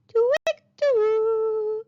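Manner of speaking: high chirpy bird call. Acted. A woodland bird calls out to the lost children, high and chirpy. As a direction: chirp it like a little forest bird. Birdsong in the story.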